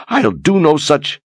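high, slow defiant cry. Acted. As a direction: cry out in pain. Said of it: "she cried out".

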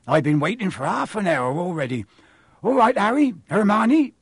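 loud rustic giant; broad country accent, rising into a hearty greeting; hearty groundskeeper. Acted. Groundskeeper greeting his young friends, hearty.

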